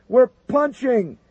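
high, slow panicked yell; bracing for a crash. Acted. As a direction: brace and yell out. Pilot ejecting from hit jet.

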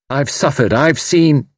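rising, high, cut short; impassioned outburst. Acted. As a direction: build heatedly, then break off. Impassioned speech breaks off.

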